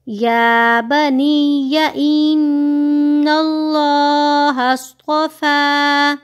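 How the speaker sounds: slow, melodic tajweed chant; teacher reciting verse slowly, melodic chant; Quran recitation. Shown as a demonstration. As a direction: draw out each vowel in recitation.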